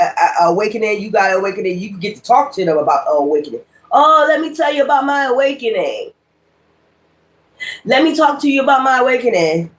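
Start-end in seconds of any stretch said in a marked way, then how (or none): 3.9-9.7 higher, showy mimic of a braggart; impersonating fake awakened people, higher and showy; mock bragging. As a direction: ham up someone boasting about their awakening. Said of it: "People that talk just like that".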